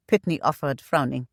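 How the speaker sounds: ordinary delivery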